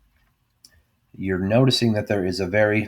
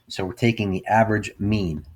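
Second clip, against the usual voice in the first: ordinary talk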